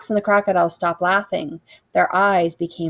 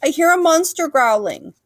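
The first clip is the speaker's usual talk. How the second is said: high, loud worried call; frightened Dragon; 10 semitones higher, 6 dB louder than usual. A narrator's reading. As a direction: tell it in fear. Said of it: "cried Dragon".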